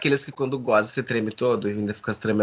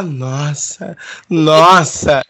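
bored, flat "nossa" imitation; mocking; 2 semitones higher, 6 dB louder, much slower than usual. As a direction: mimic a lazy moan of "nossa". Mocking partner's bland reaction.

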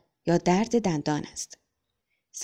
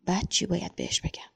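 much softer, inward murmur; lost in thought; 7 dB quieter than usual. A narrator's reading. Father, straightening his son's collar, thinks softly to himself. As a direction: ponder it quietly to yourself. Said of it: "در فکر فرو رفت".

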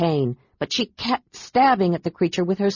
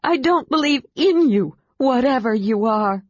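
angry defiance; 6 semitones higher than usual. Acted. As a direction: reject the phantom outright. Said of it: "She shook her head angrily".

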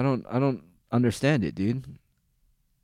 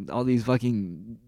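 ordinary delivery

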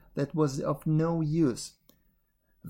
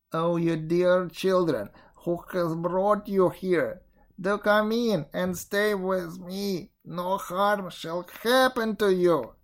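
put-on higher, drawn-out, coaxing old woman; falsely kind witch; 3 semitones higher than usual. A narrator's reading. Old witch luring lost children indoors, falsely kind and coaxing. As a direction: coax them in with slow, put-on kindness.

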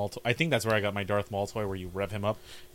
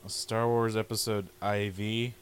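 slow, puzzled reading; slower than usual. Retold or imitated. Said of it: "And I was like".

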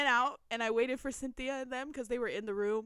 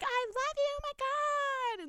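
high, drawn-out fangirl squeal; mock a gushing fan stalling at the table; annoying fangirls; slower than usual. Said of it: "Girls are being annoying as fuck".

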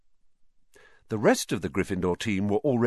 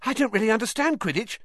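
put-on fast, high-pitched breathless boy; faster than usual. A narrator's reading. Colin asks Harry about Quidditch, breathlessly. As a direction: rush it out eagerly. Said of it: "said Colin breathlessly".

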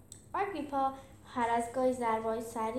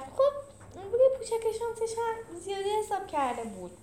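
high-pitched, tearful character voice; high voice on the verge of tears; choked up; 11 semitones higher than usual. A narrator's reading. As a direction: speak through a lump in the throat, pitched high. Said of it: "فیلیکس بغض کرد".

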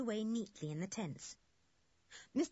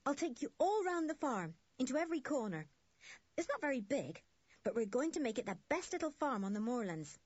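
put-on higher-pitched, keen boyish joy; joyful; 7 semitones higher than usual. A narrator's reading. Jock offers the children a farm tour, joyful. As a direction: show it off with happy eagerness. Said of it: "said Jock joyfully".

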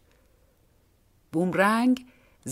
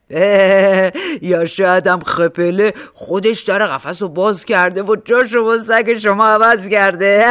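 much louder teasing policeman; mocking policeman; 10 dB louder than usual. A narrator's reading. Policeman jokes to the crying woman, loud and mocking. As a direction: tease her loudly, half joking.

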